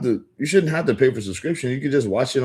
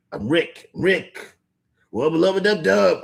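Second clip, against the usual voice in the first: higher, half-hearted cartoon catchphrase; a shaky try at the cartoon scientist, pitched up; Rick voice attempt; 8 semitones higher, slower than usual. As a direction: streamer failing at a cartoon voice for a viewer named Morty. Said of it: "I was trying to sound like Rick from Rick and Morty".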